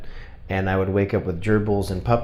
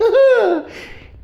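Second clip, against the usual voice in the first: rueful laugh; 6 dB louder than usual. Real, not acted. Realizing it's an extras card.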